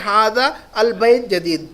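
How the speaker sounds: ھذا البیت جدید said slowly, with a long ھذا; the teacher drills the new phrase for the class; slow Arabic model reading. Shown as a demonstration. Teacher drilling the Arabic phrase for students, slow and clear. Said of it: "ھذا کو add کر دیں گے".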